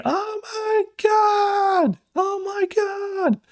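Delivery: high, stretched-out mock scream; drawn-out high crowd scream. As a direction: shriek it like a horrified crowd. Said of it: "And everyone's screaming".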